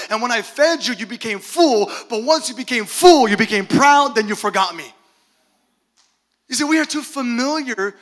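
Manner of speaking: loud, driving sermon delivery; full-force preaching. Real, not acted. Preacher contrasting hungry believers with proud, full ones, full-force preaching. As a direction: hammer each phrase home to the congregation.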